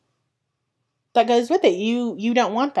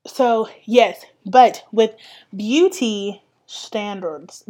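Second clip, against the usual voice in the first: ordinary talk, nothing marked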